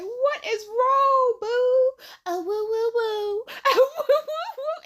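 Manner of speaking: high, loud fake sobbing 'woo woo'; mock whimpering. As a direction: ham up a whining crybaby. Mocking whiny Virgo coworker.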